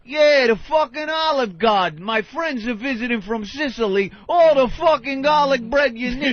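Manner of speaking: hammy Italian-American Guido voice; brash Guido braggart; Guido accent. Retold or imitated. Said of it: "most Guido motherfucker going".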